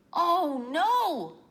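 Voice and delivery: sudden shock at losing something; dismayed gasp. Acted.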